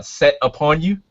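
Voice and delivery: ordinary talk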